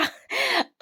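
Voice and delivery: a sudden squeal of a laugh; short high giggle; high burst of laughter. Real, not acted. Laughs at her own claim.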